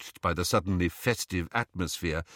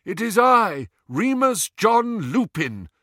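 high, raised voice carrying over a gale; calling over wind; 11 semitones higher, 5 dB louder than usual. A narrator's reading. Visitor announcing himself at the door, calling over howling wind. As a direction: shout your full name through the storm, drawn out. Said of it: "called a voice over the howling wind".

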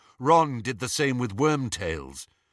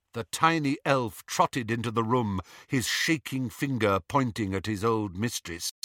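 plain reading voice

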